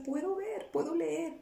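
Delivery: high, joyful cry of wonder; light up at seeing again; joyful amazement. Retold or imitated. Answering the Lord that she can see, high with joy.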